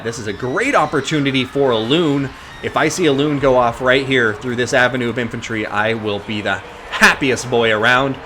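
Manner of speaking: energetic esports caster voice; live play-by-play. Acted. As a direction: call the battle like a live caster.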